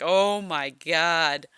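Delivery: drawn-out slow oh my God; slow stunned oh my God; slow disbelief. Retold or imitated. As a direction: stretch it out in amazement. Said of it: "I'm just like".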